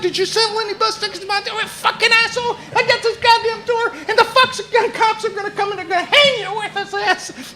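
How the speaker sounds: high, fast shrill tirade; ranting woman. Retold or imitated. Woman raging at cowboy over the door, fast high shriek. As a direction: fire it at him in a high rush.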